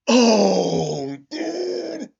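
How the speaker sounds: loud wail climbing into a high squeal; awestruck outcry. Real, not acted. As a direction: cry out, blown away. Overwhelmed by the manga panel.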